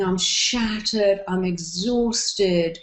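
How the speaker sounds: dragged-out tired voice; sluggish, worn-out groan; slow weary moan. Shown as a demonstration. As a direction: drawl it out like you woke up drained. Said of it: "If you wake up going".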